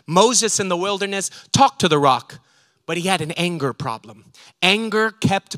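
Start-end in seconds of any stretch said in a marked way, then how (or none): none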